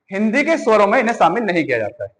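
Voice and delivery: usual speaking voice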